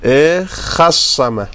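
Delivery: اخصمه drawn out, each sound held; slow drill. Shown as a demonstration. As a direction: spell out اخصمه slowly for the class. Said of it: "teacher drills the form". (اخصمه را شمرده و کشیده بگو)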